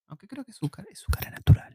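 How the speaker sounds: teasing whispered aside; whispering. Real, not acted. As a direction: whisper it behind her back. Teasing aside about the waifu.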